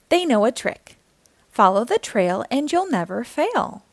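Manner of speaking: bright, lively read-aloud voice; reading to children. A narrator's reading. Storyteller reading a picture book aloud to young listeners, lively and bright. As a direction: read it like storytime for little kids.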